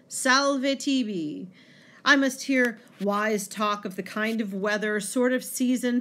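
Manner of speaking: resentful monk's monologue, varied in pace; dramatic poem reading. Acted. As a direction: act out the poem with feeling.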